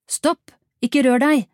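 loud, high police-style order; forceful, urgent shout; shouted command. A narrator's reading. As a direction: yell a warning to freeze.

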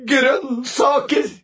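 high, loud wail of dread; horrified. A narrator's reading. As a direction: wail it in mock horror. List of boy's most dreaded things.

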